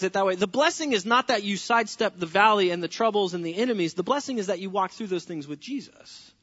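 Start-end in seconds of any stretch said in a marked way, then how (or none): none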